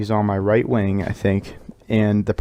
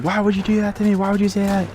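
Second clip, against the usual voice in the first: mock freaking out; 9 semitones higher, faster than usual. Joe yelling at the kid who insulted him, high mock outrage. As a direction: whine it like a teammate losing it. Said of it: "Joe's just freaking the fuck out".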